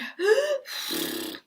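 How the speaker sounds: a high panting gasp; huffing gasp. Acted. Wolf panting while breaking the door. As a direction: gasp for breath mid-huff. Puffing breath.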